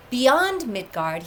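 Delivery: usual speaking voice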